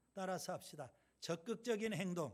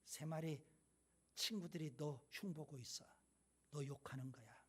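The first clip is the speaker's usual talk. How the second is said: softer, hushed gossiping lion; sly whisper; 3 semitones lower, 6 dB quieter than usual. A narrator's reading. Lion whispering to another bison, soft and sly. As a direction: murmur the lie close to his ear. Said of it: "또 속삭여요".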